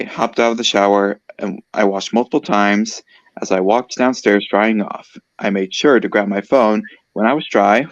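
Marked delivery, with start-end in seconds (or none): none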